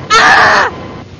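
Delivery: sudden loud startled cry; startled yelp. Real, not acted. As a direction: yelp in surprise.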